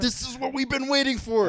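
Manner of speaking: high, loud mock Montana yokel accent. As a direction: cry it out in a put-on Montana accent. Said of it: "I give them that accent".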